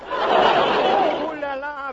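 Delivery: slow laughing ooh la la; a hammed-up French swoon; mock ooh la la. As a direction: swoon with a chuckle.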